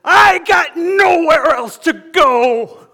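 very loud, high, drawn-out desperate cry; anguished full-voice yell; crying shout. Acted. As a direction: wail it out as a beaten recruit. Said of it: "he's crying".

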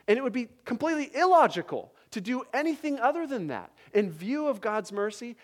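loud, high impassioned preaching; rising fervent sermon climax; preaching at full force. Acted. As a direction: drive the point home to the congregation.

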